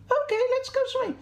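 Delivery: very high, quick sheep squeak; agreeable sheep. Acted.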